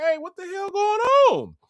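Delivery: high-pitched, incredulous call; alarmed onlookers. Retold or imitated. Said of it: "people start coming around like".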